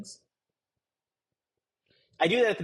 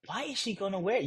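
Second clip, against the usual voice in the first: muttered disbelief; 8 dB quieter than usual. Retold or imitated. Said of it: "look at it like".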